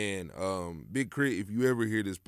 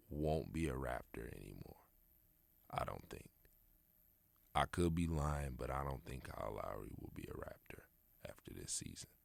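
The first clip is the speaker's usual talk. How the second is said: hushed, unvoiced aside; whisper; 7 semitones lower, 10 dB quieter than usual. Real, not acted. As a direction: drop to a secret whisper.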